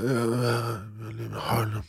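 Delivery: put-on very soft drunken mumble; retold drunk slur. Drunk passenger giving the cabbie an address, soft mumble. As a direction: play a passenger too drunk to speak up. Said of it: "jag var för full".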